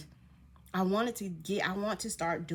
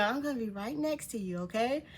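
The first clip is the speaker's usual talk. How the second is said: put-on higher-pitched little girl, reassuring; 5 semitones higher than usual. Little girl promising to stay beside her, reassuring. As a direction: imitate a child offering support, pitched up. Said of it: "the little girl Demi was like".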